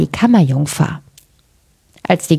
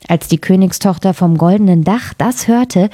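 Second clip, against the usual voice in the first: ordinary delivery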